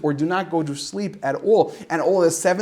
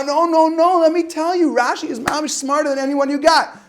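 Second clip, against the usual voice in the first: mock eager insisting; 9 semitones higher, 4 dB louder than usual. Insisting to the sheikh.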